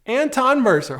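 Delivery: high, loud, disbelieving cry of the name; the name Anton Mercer yelled high by a stunned fan; starstruck fan. Retold or imitated. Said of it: "he goes".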